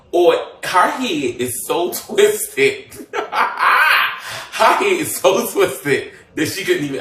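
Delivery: slow, drawn out through laughter; laughing at how twisted the rumour is; laughing disbelief. Real, not acted. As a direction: laugh while stretching the words.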